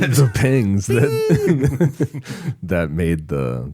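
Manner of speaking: laughing through the words; trying to talk through a giggle. Real, not acted. Cracks up mid-sentence.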